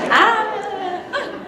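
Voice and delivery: loud, high squeal with laughter; happy squeal. Retold or imitated. She was so happy, delighted squeal. Reliving her happiness at the news.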